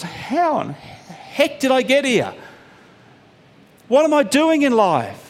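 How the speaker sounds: high, slow dismayed question; bewildered. Retold or imitated. Preacher voicing a lost believer, high slow dismay. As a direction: wake up lost and ask it aloud.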